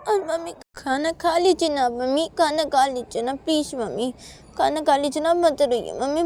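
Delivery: put-on high, coaxing child voice; pleading little daughter. Child coaxing crying mummy to eat, pleading.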